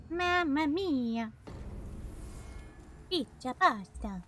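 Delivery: slow, high, hammy Italian chef; jolly italian pizza chef. Pretend. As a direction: sing it out like a cartoon pizza chef. Gamer does Italian stereotype.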